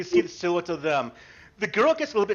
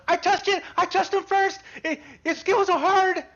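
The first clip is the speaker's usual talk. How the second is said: put-on playful kid squeal; 12 semitones higher than usual. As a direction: crow it like a kid who won the dare. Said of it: "run away screaming, but, like, in a playful scream".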